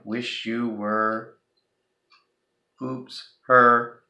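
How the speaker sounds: slow "Wish you were", pause, then a loud "her"; punchline "her" after a pause; dramatic pause before "her". Shown as a demonstration. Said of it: "He left off the E".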